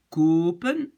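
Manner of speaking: kopen with a long, closed O, like "koh-pen"; wrong long vowel. Shown as a demonstration. Teacher shows a single-P plural gone wrong, long O in kopen. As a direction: stretch the O in kopen loudly. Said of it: "si je le laisse comme ça".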